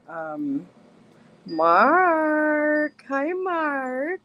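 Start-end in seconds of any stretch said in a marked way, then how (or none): 1.5-4.2 excited greeting. Real, not acted.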